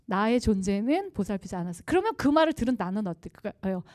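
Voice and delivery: usual speaking voice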